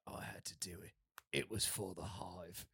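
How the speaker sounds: very low, quiet action-hero imitation; solemn film-hero line. Mimicking the hero with guns pointed at him, solemn low voice. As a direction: drop it low and hushed, mock grave. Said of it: "and he's like".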